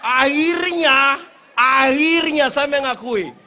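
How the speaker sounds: very loud, slow, shouted with laughter; loud laughing punchline. Real, not acted. Preacher delivering the coconut punchline, slow loud laughing shout.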